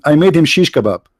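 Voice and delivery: ordinary talk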